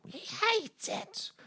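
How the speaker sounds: high, hushed, drawn-out whine; mock whispered verdict. Giving the verdict on the film, mock whispered whine. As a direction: squeeze it out slowly and softly.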